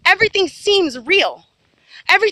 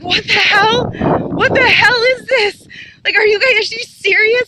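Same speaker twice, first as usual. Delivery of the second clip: excited disbelief; 5 semitones higher, 3 dB louder than usual. Retold or imitated. Amazed at telepathic contact.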